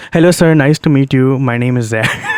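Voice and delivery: put-on loud, heavy Indian accent on the greeting; thick Indian accent. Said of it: "I hit him with that fat accent".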